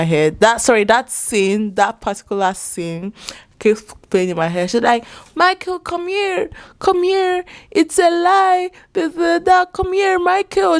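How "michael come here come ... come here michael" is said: much higher, bossy mock wife voice; high mocking impression of a wife summoning her husband; imitating Dalia. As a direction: mimic her ordering her husband over, pitched way up. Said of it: "still commanding her man".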